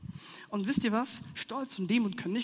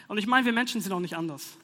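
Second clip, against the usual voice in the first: normal talking voice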